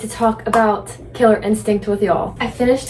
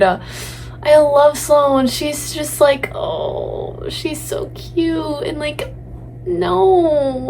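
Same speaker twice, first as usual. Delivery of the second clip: adoring gush; 5 semitones higher, much slower than usual. Real, not acted. Gushing over favorite character.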